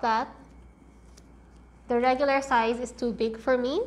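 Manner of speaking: muffled behind fabric mask; speaking with mouth covered; through a cloth mask. Real, not acted. Seen 4: Patterned cloth mask covers her mouth and nose throughout the labelled words.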